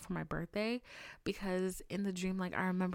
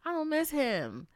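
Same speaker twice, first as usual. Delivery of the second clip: overdone denial to her friend; emphatic denial; 2 semitones higher, 5 dB louder, slower than usual. Retold or imitated. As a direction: protest a bit too much. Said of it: "I was like".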